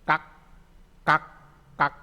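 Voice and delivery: "Kak" with a clipped final k, each one separate; batchim demo. Shown as a demonstration. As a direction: model "kak" for students, stopping the k. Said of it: "Ini contoh akhirnya nih".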